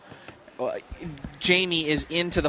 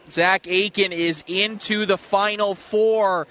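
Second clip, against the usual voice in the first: announcer voice; 4 semitones higher than usual. Acted. Announcing next matches.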